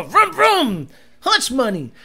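high revving vroom noises; mock engine revving. Streamer mocking car guys with high revving vroom. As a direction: rev it like a show-off car.

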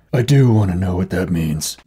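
low, deadpan figure voice; [name] in character. Acted. [name] turns to Basil, in a low character voice. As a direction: drop the pitch well down for the character. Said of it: "she's just like".

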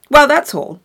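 abrupt. A narrator's reading. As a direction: snap the story shut, abruptly. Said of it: "he said abruptly".

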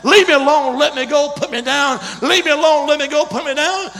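put-on loud child yelling. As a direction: fight and yell. Boy screaming at his dad.

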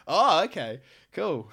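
loud, high "ah, got it" reaction; mock sudden realisation. Tourists learning the slang is harmless, acted relieved realisation. Retelling relief at crack meaning fun.

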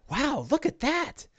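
high-pitched hushed wonder; high, lilting amazement; awed sidekick Bing. Acted. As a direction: marvel in a high little voice. Bing marvels at the hole.

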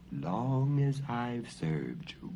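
a hint of a grumbling dog; growled. A narrator's reading. Dog growling back at its mistress, slow soft grumble. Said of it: "growled the dog".

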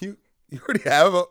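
high, loud, very fast astonishment; mock Arthur. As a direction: Arthur sputtering in disbelief. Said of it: "He's like".

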